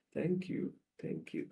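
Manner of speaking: very soft, acted thanks; humbly grateful, almost under the breath; meek gratitude. Woman grateful for scraps of love.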